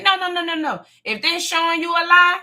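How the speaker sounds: usual speaking voice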